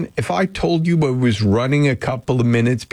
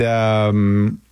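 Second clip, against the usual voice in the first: ordinary delivery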